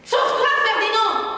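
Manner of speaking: mother crying out for her son to flee; frantic cry. Acted. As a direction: scream at him to run. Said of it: "Qu'elle a encore le temps de me crier".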